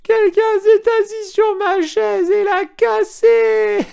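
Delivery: very high, loud, slow squeaky wail; little bear's tiny voice. A narrator's reading. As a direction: wail it like a cub whose chair is broken. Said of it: "s'écria de sa petite voix". (petite voix d'oursonne, très aiguë et forte)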